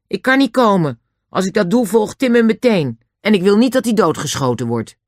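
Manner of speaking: put-on high, loud child's protest; protesting George. George refuses Lou to protect Tim, high and insistent.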